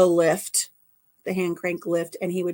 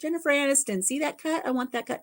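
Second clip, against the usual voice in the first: high eager request; 6 semitones higher than usual. Retold or imitated. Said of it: "I'm like".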